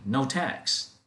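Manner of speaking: ordinary talk, nothing marked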